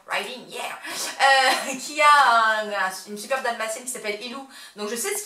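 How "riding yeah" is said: English accent. Shown as a demonstration. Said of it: "excuse-moi pour mon super accent anglais".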